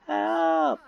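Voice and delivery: high, hammed-up wail at normal volume; mock scream for help. Kuth as his memory gets erased, mock scream for help. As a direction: pretend panic, pitch climbing on each cry. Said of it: "They're all screaming for help".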